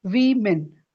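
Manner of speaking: वीमेन broken up as VEE-men; teacher's careful model of वीमेन; correct pronunciation. Shown as a demonstration. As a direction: sound out वीमेन with an ee up front. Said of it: "it is women".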